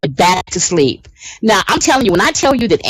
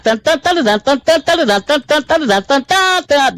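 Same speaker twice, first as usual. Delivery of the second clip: insistent, high repeating pulses; imitated alarm; 7 semitones higher than usual. Showing how his alarm sounds, relentless high imitation. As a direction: pound out an alarm rhythm in high notes. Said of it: "That's his alarm".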